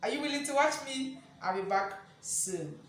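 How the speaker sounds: usual speaking voice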